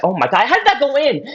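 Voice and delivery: incredulous quote of a beaten rival; retold frustration. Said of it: "he was getting frustrated".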